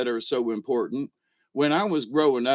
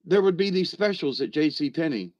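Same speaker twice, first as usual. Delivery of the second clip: usual speaking voice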